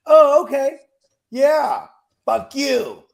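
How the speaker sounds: loud, punchy sarcastic comeback; mock annoyed reply. Worker answering a useless callback, mock annoyed. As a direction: start high and loud, land the insult.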